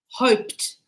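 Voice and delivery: Hoped as one syllable ending in t; single syllable, final t. Shown as a demonstration. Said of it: "One word".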